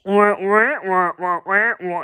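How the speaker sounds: muted trombone wah-wah like Peanuts adults. Retold or imitated. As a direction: Peanuts grown-up gibberish, trailing off. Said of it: "And she's".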